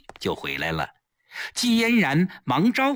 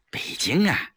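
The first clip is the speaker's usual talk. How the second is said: whispered, faltering dodge; hesitant, evasive; 4 semitones lower than usual. A narrator's reading. Wife dodging her friend's question about Beijing, evasive. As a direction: trail off and avoid the question. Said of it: "支支吾吾地说".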